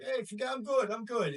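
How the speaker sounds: a New York guy insisting he's fine; New York tough guy. Retold or imitated. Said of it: "I'm an Italian from New York".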